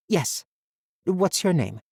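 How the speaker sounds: put-on high, bright reassuring girl; girl's voice. A narrator's reading. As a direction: keep the boy's spirits up. Girl cheering up the boy.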